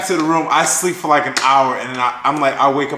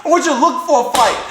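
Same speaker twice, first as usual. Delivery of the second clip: higher, fast exasperated mock scolding; retold scolding; 8 semitones higher, faster than usual. Scolding Trevor for sitting on the couch, exasperated mock rant. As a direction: replay the nagging, fast and high.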